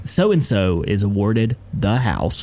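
put-on old-school will reading. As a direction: pronounce it like a movie lawyer, slow and low. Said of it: "movie reading of the will".

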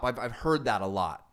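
usual speaking voice

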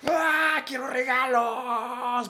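put-on high, slow kid's wail for presents; a greedy child howling for gifts; whiny child shout. Kids demanding presents.

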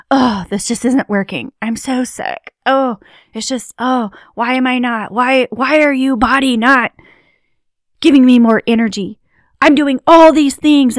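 rising from tired whine to loud exasperated rant; whiny frustrated complaint. Shown as a demonstration. Demoing the victim mindset, a whine growing into a loud rant. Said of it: "the disempowering approach of".

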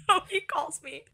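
ordinary delivery

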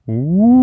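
loud, rising ghost "ooh"; ghostly wail. A narrator's reading. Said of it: "she heard".

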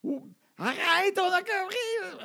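high, slow, drawn-out mock whine; exasperated reply. Kids protest repeated supper calls, exasperated high whine. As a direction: imitate fed-up kids dragging out protest.

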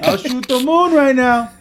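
high, slow cartoon villain; mock madman threat. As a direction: stretch it out like a cartoon lunatic. Acting out a trigger-happy official.

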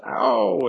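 high, loud "ow"; mock cry of hurt; pained cry. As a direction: yelp in pain, acted. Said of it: "And she goes".

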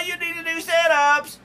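high, loud taunting voice; mocking. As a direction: tease someone about their belly. Mocking people's bellies.

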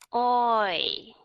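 "oi" glided from o to i; clear oi sound. Shown as a demonstration. Teacher introducing the oi vowel. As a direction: model "oi" as two sounds. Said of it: "Hai âm ở trong này".

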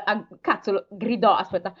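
usual speaking voice

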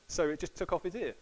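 ordinary talk

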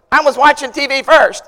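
lightly acted childish protest; mad little sister. Little sister to big brother over the channel, mad. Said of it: "she was mad that I turned her channel".

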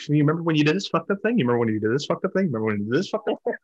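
fast, repeating and tumbling over itself; nagging rapid-fire reminder. Retold or imitated. Brain needling him about the past, rapid repeated nagging. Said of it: "plant that little nugget of".

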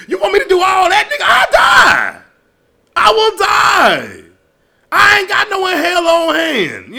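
shouting that they'll die mid-act; exasperated shout. Real, not acted. Ranting at a partner about dirty talk, shouting.

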